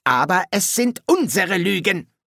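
attempted drill-corporal bark; Strappi. A narrator's reading. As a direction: try to mimic a barking corporal. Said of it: "Strappis bellende Stimme nachzuahmen".

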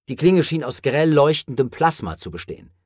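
plain reading voice

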